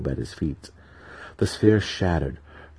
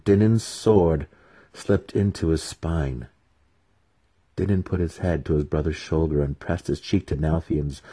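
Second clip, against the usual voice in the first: ordinary delivery, nothing marked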